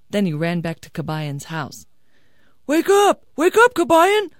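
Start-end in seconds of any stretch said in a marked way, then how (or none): 2.7-4.3 high, loud shout; urgent wake-up call. Acted. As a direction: rouse a sleeper with a shout. Father-in-law rouses the sleeper.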